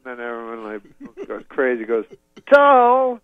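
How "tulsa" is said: loud, high, drawn-out yell; crowd shout. Retold or imitated. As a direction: whip the crowd into boos. Rousing stadium crowd.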